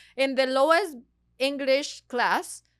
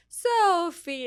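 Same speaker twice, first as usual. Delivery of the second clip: lightly voiced mom, higher and louder; American mom calling; 7 semitones higher, 6 dB louder than usual. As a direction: call her name like a fond mom. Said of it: "it's like".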